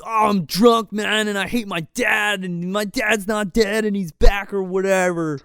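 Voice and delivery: lightly hammed-up drunken teen whine; mock drunk. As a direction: imitate a drunk kid griping about dad. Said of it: "He's like".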